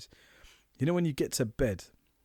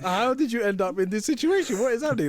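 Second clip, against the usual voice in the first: surprised; 9 semitones higher, 4 dB louder than usual. Retold or imitated. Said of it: "I knew that you would be like".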